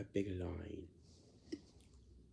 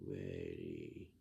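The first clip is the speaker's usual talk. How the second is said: very low, drawn-out lazy drone. Retold or imitated. Adult mimicking someone's lazy answer at chores time, lazy. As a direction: imitate a lazy, effortless answer, pitched far down. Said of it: "you sound like this".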